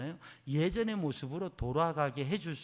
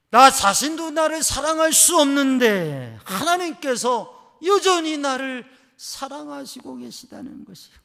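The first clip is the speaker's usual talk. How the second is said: much higher, loud, slow proclamation; preaching at full force; 12 semitones higher, 18 dB louder than usual. Acted. Preacher proclaiming God's love, loud and slow. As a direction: lift it up to the rafters.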